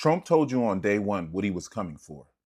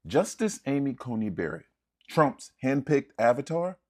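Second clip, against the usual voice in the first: ordinary talk, nothing marked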